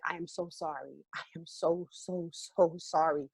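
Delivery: very soft, nearly silent apology; barely voicing sorry to the families; mouthed apology. Actor mouthing sorry to grieving families, near-silent. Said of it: "I'm just mouthing it to the families".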